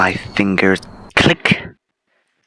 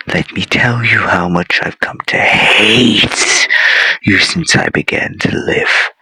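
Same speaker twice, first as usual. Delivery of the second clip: ordinary talk, nothing marked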